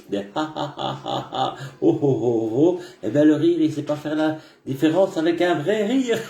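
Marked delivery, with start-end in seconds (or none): 0.1-2.8 simulated ha ha laughter; fake laugh. Shown as a demonstration. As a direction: demonstrate a forced laugh. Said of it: "des rires simulés".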